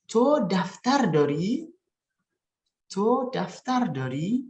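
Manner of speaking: slow and high, rising on the end of dori; question intonation. Shown as a demonstration. Teacher models a Farsi question, slow rising intonation. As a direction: stretch it out and lift dori into a question. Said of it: "membacakan dengan intonasi bertanya".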